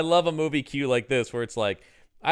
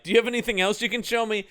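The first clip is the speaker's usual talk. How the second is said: quick, higher picky shopper voice; fast mock customer; 7 semitones higher, much faster than usual. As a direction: rattle it off like a fussy shopper. Acted morgue shopping dialogue.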